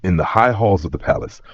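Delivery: ordinary delivery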